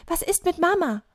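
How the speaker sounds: deutlich höher, angedeutet panisch; panische Frage. A narrator's reading. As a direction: frag erschrocken nach Mama, ohne echten Schrei. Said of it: "panisch schreit er". (milde gespielte Panik)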